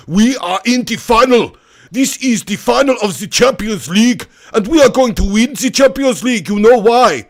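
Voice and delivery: loud, high mock German accent. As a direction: declare it in a hammed-up German accent. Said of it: "And then the German".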